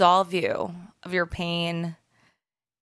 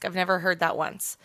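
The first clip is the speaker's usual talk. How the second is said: usual speaking voice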